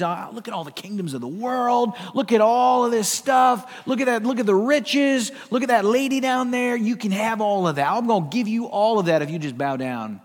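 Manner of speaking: eager high-pitched sales pitch, settling toward the end; lightly voiced Satan. Satan tempting Christ on the mountain, mildly hammed-up enticement. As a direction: tempt with mock excitement, pointing out each prize. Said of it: "Satan takes him up to the highest mountain".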